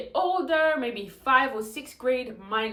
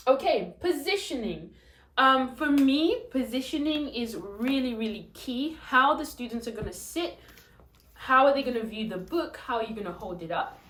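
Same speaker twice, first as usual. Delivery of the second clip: usual speaking voice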